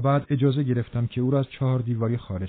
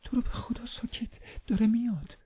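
much higher and much softer, hushed in fear; frightened hushed plea; 11 semitones higher, 6 dB quieter than usual. Acted. Linton pleading with Catherine as his father nears, frightened hush. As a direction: beg her to hush before he comes. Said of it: "لینتون آهسته گفت".

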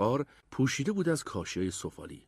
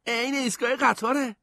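put-on high, louder kid voice; excited boy; 12 semitones higher, 5 dB louder than usual. A narrator's reading. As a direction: report a discovery to your brother, thrilled.

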